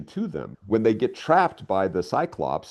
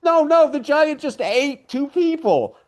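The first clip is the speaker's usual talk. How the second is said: much higher and louder, slow, each word stretched in disbelief; incredulous outburst; 6 dB louder, slower than usual. Real, not acted. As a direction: insist it's a huge deal. Outraged correction of the throwaway.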